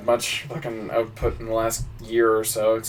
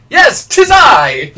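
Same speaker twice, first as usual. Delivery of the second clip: much higher, loud, slow proclamation; nervous comedian; 13 semitones higher, 12 dB louder, much slower than usual. Retold or imitated. Comedian panicking before the queen, loud and slow. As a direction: blurt it out grandly, terrified. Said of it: "he went".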